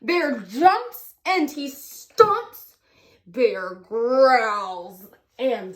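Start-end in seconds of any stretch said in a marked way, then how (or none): none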